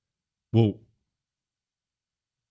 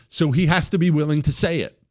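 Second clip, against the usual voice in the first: ordinary talk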